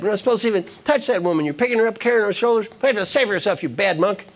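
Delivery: high, indignant scolding; acted monk's heated rant; angry monk. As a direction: pile up the accusations in mock outrage. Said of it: "The other monk is angry".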